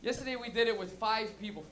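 normal talking voice

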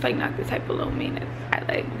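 a hushed complaint; whispered; whispered aside. Real, not acted. Aside about food.

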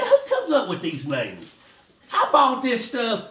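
chuckling disbelief; amused, poking fun at the names; laughing. Real, not acted. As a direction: question through a laugh.

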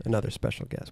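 hushed whispered aside. Pretend. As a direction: whisper it like a secret. Host repeats the intro.

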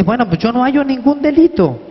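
a governor shrugging off the charge; Pilate protesting. A narrator's reading. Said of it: "Y dice". (Pilato declara inocente a Yeshua, voz más aguda)

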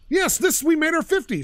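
high triumphant yes; real excited cheer. Real, not acted. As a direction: celebrate hitting the target. Cheers at reaching fifty.

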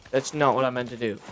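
ordinary talk

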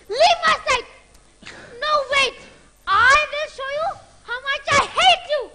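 furious jealous shouting. Acted. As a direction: scream the betrayal at him. Jealous fiancée rages at lover.

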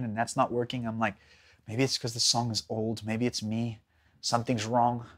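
low, soft anxious murmur; quiet despair. Retold or imitated. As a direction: sink into worry. Fearful despairing inner talk.

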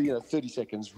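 ordinary talk

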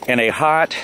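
ordinary talk, nothing marked

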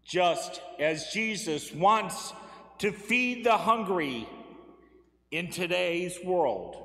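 ordinary delivery

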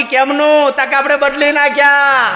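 high, loud put-on voice of a brazen woman; sister-in-law proudly says she remarried, high and loud; showy widow. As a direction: toss it off shamelessly in a shrill lady voice. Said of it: "એ જ ભાભી એવડો મોટો ચોલ્લો".

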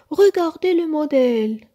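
high, loud call to friends; shouting. A narrator's reading. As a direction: shout it out to the others. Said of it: "cria-t-il à Peach et à Cathy".

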